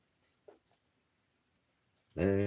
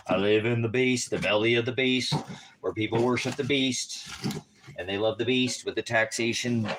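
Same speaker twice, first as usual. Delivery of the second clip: louder, rhythmic preacher rant; fiery street preaching; 4 semitones higher, 3 dB louder than usual. Acted. Ranting at viewers about the beast system, preacher style. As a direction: preach it at the crowd.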